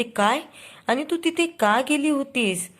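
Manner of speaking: mock yell of disbelief, pitch jumping up; shout. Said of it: "प्रतिमा एवढ्या जोरात ओरडते".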